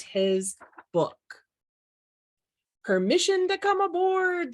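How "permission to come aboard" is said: shouting. A narrator's reading. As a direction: call out eagerly to the ship. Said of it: "shouted Rufus".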